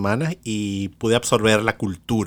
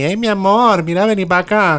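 high and louder hammed-up Maracaibo accent; strong Maracaibo accent; 10 semitones higher, 7 dB louder than usual. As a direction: belt it like a proud maracucha. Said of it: "te habla así".

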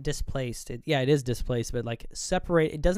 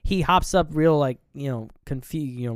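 ordinary talk, nothing marked

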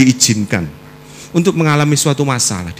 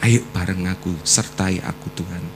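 quiet retold prayer; 5 semitones lower, 8 dB quieter than usual. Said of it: "saya selalu katakan".

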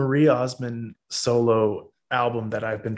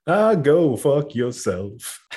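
slow mock-offended jab; 3 semitones higher, much slower than usual. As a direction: drag it out in fake outrage. Mock insult to friend, laughing.

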